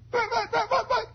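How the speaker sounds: put-on very high stuttering boy; stammering kid. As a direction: sputter helplessly.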